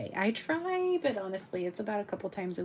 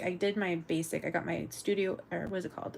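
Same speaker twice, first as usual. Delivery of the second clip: ordinary talk, nothing marked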